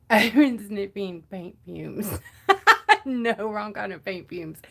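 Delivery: loud laughter through words; laughing. Real, not acted. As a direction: laugh out the line. Laughing loudly at own joke.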